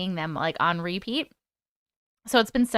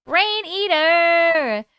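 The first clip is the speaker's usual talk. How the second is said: drawn-out greeting; 8 semitones higher, 6 dB louder, much slower than usual. Real, not acted. Greeting a chat viewer.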